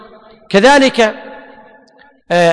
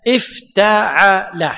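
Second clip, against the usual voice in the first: slow model word; 3 semitones lower, much slower than usual. Shown as a demonstration. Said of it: "teacher models the pattern".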